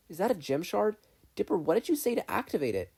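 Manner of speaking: put-on high, loud boy's questions; curious boy. Steven asking Dipper about the gem, high and urgent. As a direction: probe urgently like a kid.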